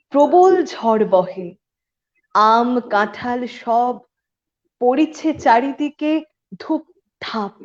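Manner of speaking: slow, rhythmic verse with stretched words; dramatic recital, lifting the key words; poem recitation. Acted. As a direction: recite a rain poem in lilting, emphatic beats.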